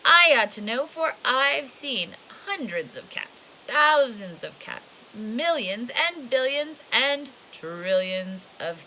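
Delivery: slow, drawn-out counting; old man proudly drawing it out; slow boastful list. Acted. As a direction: savor each number, slowly.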